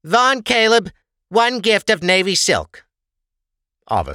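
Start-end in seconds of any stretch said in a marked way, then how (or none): 0.0-2.8 high, loud official call; calling out order. A narrator's reading. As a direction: announce the order. Clerk calls out order.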